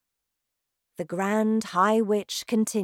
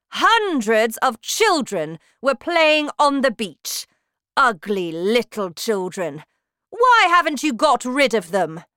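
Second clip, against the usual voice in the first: shouting witch; 6 semitones higher, 9 dB louder than usual. Acted. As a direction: bellow like a terrifying witch queen. Grand High Witch rants.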